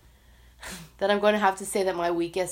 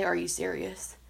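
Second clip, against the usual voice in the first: muttered disbelief; 7 dB quieter than usual. Retold or imitated. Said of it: "again, I say".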